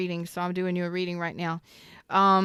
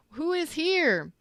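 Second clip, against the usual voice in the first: calling out; 9 semitones higher, slower than usual. Real, not acted. Streamer calling out to chat.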